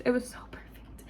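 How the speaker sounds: breathy whispered repeat of it was so perfect. Real, not acted. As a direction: whisper it to yourself, awed.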